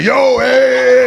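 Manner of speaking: high, very loud drawn-out call; mock stage holler. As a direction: imitate someone hollering from the stage. Imagines woman yelling from stage.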